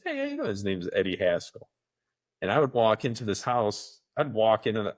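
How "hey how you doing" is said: imitating a cheesy sitcom kid, much higher and bright; dorky sitcom kid. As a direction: pop in like a goofy TV neighbor kid. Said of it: "happy, dorky kid comes in and he goes".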